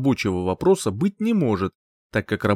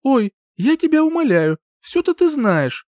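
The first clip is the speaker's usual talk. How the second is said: exasperated teasing; 14 semitones higher, 4 dB louder than usual. Acted.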